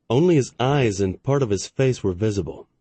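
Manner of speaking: usual speaking voice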